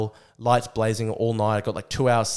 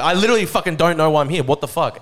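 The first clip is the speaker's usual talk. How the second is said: louder, higher, fast exasperated protest; exasperated outburst; 5 semitones higher, 6 dB louder than usual. Retold or imitated. Protester shouting back at the police, fast and exasperated. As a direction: blurt it out in frustrated disbelief. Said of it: "And I'm like".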